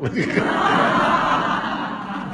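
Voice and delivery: a loud, high laughing burst; burst of laughter. Real, not acted. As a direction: break out laughing at the memory. Laughs at his own anecdote. (carcajada fuerte y aguda)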